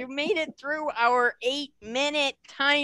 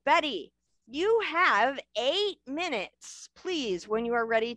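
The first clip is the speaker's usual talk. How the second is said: plain reading voice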